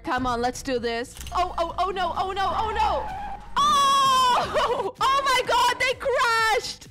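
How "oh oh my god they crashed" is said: high, loud shock and laughter; gleeful disbelief as the cops crash; excited shout. Real, not acted. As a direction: yelp at the crash. Pursuing police cars crash.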